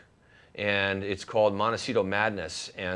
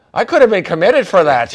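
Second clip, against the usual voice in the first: playful exclaim; 10 semitones higher, 13 dB louder than usual. Real, not acted. Joking punchline about being committed.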